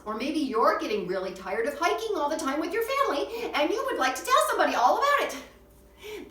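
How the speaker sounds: loud, high, building exasperation; exasperated outburst. Acted. Kid tired of family hikes telling somebody, exasperated outburst. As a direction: build to a hammed-up, fed-up high shout. Voices a kid fed up with hiking.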